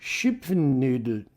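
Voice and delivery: Schupfennudel said slowly, syllable by syllable; careful German attempt. Shown as a demonstration. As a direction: sound out Schupfennudel slowly, like a learner. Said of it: "I'm trying my best".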